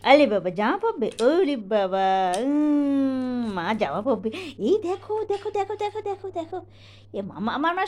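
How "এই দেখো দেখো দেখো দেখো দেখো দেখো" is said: high coaxing. Real, not acted. Parent distracting a fussing child.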